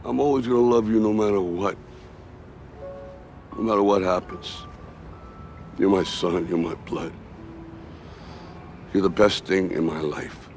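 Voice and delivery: quiet, heartfelt devotion to a son; tender fatherly love. Acted. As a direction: drop the voice low and mean every word. Father reassures son of his love.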